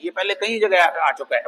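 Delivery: ordinary delivery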